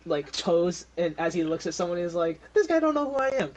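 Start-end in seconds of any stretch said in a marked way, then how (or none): 2.5-3.5 high, loud get-a-load-of-this-guy scoff; incredulous, pitched way up; mocking disbelief. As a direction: scoff to the onlookers about this nobody. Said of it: "get a load of this guy".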